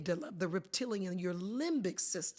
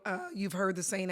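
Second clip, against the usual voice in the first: normal talking voice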